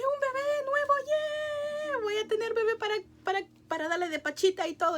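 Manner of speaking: festejo agudo que va bajando; alegría revivida. Retold or imitated. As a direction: revivir la ilusión con un chillido alargado. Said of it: "yo estaba contenta". (celebración aguda y contenta)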